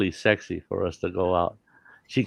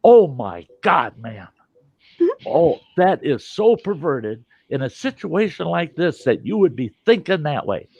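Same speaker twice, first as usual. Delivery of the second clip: shocked wife; 6 semitones higher, 3 dB louder than usual. Retold or imitated. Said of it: "she goes".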